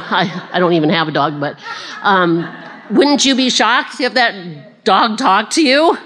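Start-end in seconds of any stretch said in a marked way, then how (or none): none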